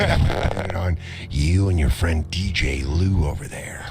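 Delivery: deep, taunting villain; deep taunting psycho; creepy killer voice. Acted. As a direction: tease the threat, low.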